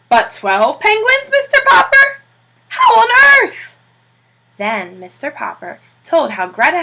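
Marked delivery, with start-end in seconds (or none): 0.1-3.8 high, loud, slow amazed cry; astonished. A narrator's reading. Admiral amazed that Popper has twelve penguins, shouting.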